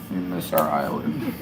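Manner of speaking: very low, soft repeat; sunken, hammed-up lament; mock-mournful low voice. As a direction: drop right down for comic longing. Same line repeated far lower.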